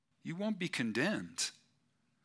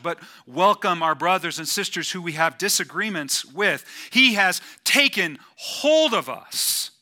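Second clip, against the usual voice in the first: loud, rising preacher's fervor, driving each line home; full-force preaching; 4 semitones higher, 9 dB louder than usual. Acted. Pastor to his church at full force, loud and fervent preaching. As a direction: pour it out like the climax of a sermon.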